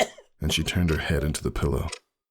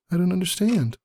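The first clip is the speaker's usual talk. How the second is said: higher, louder puzzled mare; confused Roseluck; 3 dB louder than usual. A narrator's reading. Roseluck asks Ember what he means, confused. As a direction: ask him, baffled.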